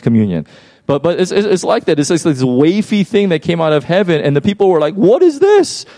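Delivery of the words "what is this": high-pitched, astonished question; baffled exclamation. Acted. People seeing manna fall from heaven, baffled exclamation. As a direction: blurt it out, pitch leaping up in bewilderment. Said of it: "question mark, exclamation point".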